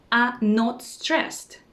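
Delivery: ordinary talk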